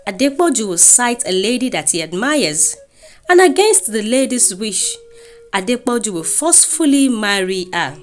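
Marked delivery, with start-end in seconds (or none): none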